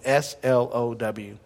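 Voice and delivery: slow hushed spelling; spelled out slowly. Shown as a demonstration. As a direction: draw out each letter. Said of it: "the way Jesus spelled it".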